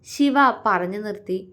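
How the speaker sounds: usual speaking voice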